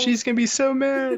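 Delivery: high falsetto mock wail, half laughing; mock hysteria. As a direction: squeal it in fake distress. Listener joins the mock hysteria.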